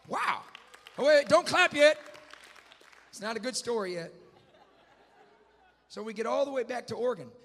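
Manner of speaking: high, very loud preaching burst; excited shout. Real, not acted. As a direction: holler over the crowd to hold their applause. Excited preacher halting premature applause.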